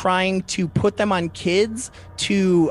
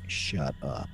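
much lower, very soft imitated reaction; hushed disbelief; 12 dB quieter than usual. Imitating the sister reacting to the news, hushed disbelief. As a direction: drop it low and quiet, as if stunned. Sister's stunned reply, acted.

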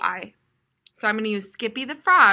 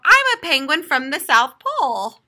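louder proud announcement; proud little turtle; 4 semitones higher, 8 dB louder than usual. A narrator's reading. Little turtle telling the kids, loud and proud. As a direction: show off cheerfully. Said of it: "said Little Turtle".

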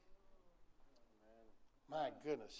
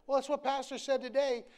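loud, higher defensive retort; defensive spouse; 7 semitones higher, 9 dB louder than usual. Acted. Spouse justifying the sermon to the other, loud and defensive. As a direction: push back, pleading authority.

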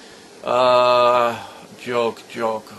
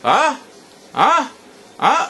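sharp, much higher, puzzled huh; confused huh; 11 semitones higher, 4 dB louder than usual. Real, not acted. Confused as the recording stops.

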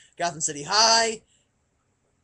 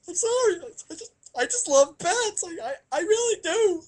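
mock weepy; 8 semitones higher than usual. Comic breakdown over bats.

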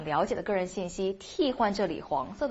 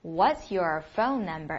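slow model question; 3 semitones lower, slower than usual. Shown as a demonstration. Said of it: "So you ask".

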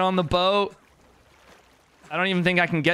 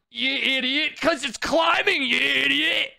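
real frustrated shout, pitched up; self-berating shout; 7 semitones higher than usual. Real, not acted. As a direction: scold yourself for the dumb mistake. Berating himself over space bar.

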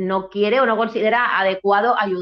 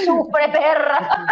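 mock sneer; 8 semitones higher, 3 dB louder, much slower than usual. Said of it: "Y por detrás".

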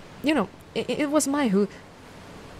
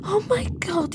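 high startled exclaim; surprised gasp; 9 semitones higher than usual. Acted. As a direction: caught off guard. Discovers a human.

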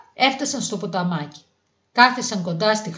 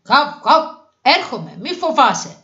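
raised, loud rescuer's call after barks; dog calling out; 4 semitones higher, 5 dB louder than usual. A narrator's reading. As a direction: loyal dog shouting that help is coming. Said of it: "φώναξε ο πιστός". (δυνατό κάλεσμα πιστού σκύλου)

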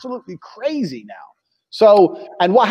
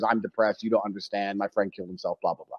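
much lower and softer mock whine, fading out; mocking online critics; 12 semitones lower, 11 dB quieter than usual. Mimicking anonymous online attackers, low, soft and fading. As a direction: mumble it low like a sulking critic. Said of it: "all the Avatar Anon characters".

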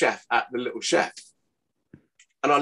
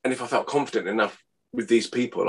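ordinary delivery

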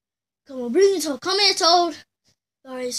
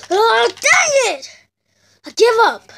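angry gamer outburst; 6 semitones higher, 7 dB louder, slower than usual. Pretend. Dies again in the game.

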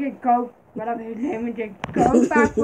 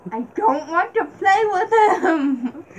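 laughing; 5 semitones higher, 4 dB louder, slower than usual. Real, not acted. Laughing while retelling.

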